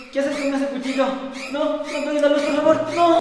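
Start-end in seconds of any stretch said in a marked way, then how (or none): 1.5-3.2 higher, pleading please don't; pleading scared. Acted. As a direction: beg him not to kill the lights. Pleading victim in horror bit.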